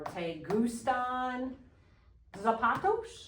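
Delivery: slow question, "zapatos" rising at the end; sounds like asking. Shown as a demonstration. Teacher modelling a question for class, slow with final rise. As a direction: draw out "gustan", then lift "zapatos". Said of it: "make sure it sounds like you're asking".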